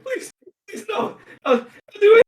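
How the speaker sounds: very high, whimpering plea with a crack of laughter; panicked begging. Acted. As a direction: beg for mercy in a tiny terrified voice. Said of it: "panicking and crying out to you".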